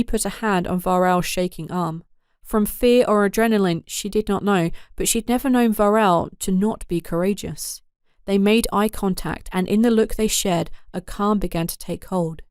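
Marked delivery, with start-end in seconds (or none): none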